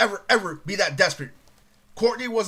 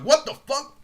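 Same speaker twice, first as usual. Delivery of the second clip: shocked; 6 semitones higher, 4 dB louder than usual. Retold or imitated. Shocked at Courtney's remark.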